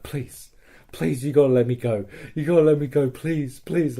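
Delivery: pleading. Retold or imitated. Captive begs the narrator to let him go, mock pleading. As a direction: plead like a captive asking for release. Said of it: "this guy's pleading with me".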